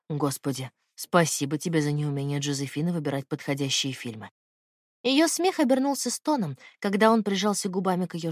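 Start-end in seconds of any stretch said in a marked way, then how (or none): 0.1-4.3 lightly lowered man's voice, intimate; low male lover voice. Acted. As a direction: speak low as the tender man.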